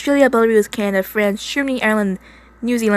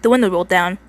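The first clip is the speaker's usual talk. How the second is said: ordinary delivery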